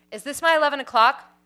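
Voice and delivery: crisp and businesslike, like an intercom greeting; clipped male voice. A narrator's reading. Producer greets the visitor over the buzzer, clipped. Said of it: "a clipped male voice came at me".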